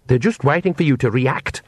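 ordinary delivery

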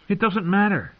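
high-pitched, assured dismissal; confident. A narrator's reading. Bran to wary Mira, confidently. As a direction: brush the worry aside confidently. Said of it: "he said confidently".

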